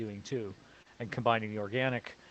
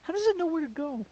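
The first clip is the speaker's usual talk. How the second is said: mock-naive; 4 dB louder than usual. Real, not acted. High mock-naive question.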